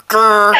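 loud, high comic growl; hammed-up "grr" of frustration; mock angry growl. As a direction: growl in exaggerated annoyance. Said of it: "you sound very upset".

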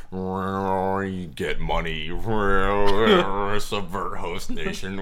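slow, drawn-out creature voice; a parasite droning orders into its host's thoughts. Acted. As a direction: drag the words out like a creature. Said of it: "what they hear in their thoughts".

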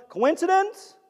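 high, sly mock wonder; ironic question. Preacher to congregation, high and knowingly ironic. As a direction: tease the word like you know better.

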